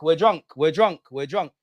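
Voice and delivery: ordinary talk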